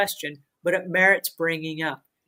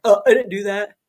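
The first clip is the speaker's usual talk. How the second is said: louder, put-on voice, playing innocent; mock denial; 3 semitones higher, 8 dB louder than usual. Cleaner dodging blame with the homeowner, loud mock innocent denial. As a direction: act out a sneaky cleaner brushing it off. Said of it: "sneak away and be like".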